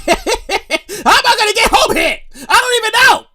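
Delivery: high, stretched-out whine with a laugh; mock despair. Stranded rider wondering how to get home, mock wailing. Second take, acted despair.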